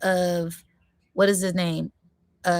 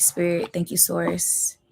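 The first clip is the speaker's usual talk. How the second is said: ordinary delivery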